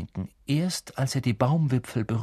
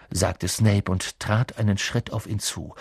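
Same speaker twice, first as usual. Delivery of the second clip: usual speaking voice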